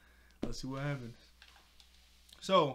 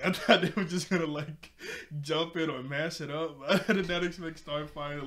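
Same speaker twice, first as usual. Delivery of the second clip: laughing; 2 semitones higher, 7 dB louder than usual. Real, not acted. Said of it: "that really surprised me".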